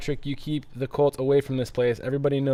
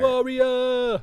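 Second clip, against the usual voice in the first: battle cry; 11 semitones higher than usual. Pretend. Battle cry after "Warrior.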